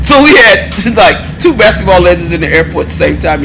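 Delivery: excited. Real, not acted. Amazed at two celebrity sightings.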